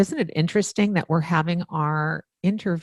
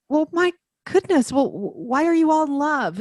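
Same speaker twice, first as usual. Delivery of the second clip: high, incredulous retold question; amazed; 8 semitones higher than usual. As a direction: play up her own bafflement, pitched up.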